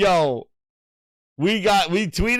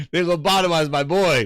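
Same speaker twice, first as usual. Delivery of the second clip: ordinary delivery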